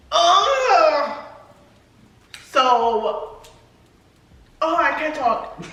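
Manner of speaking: laughing through tears, words breaking; real emotion, fighting back tears while laughing; tearful laughter. Real, not acted. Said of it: "I'm gonna cry right now".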